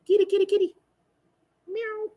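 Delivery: very high sing-song pet voice; calling the cat. Real, not acted. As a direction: coo at the cat in a squeaky voice.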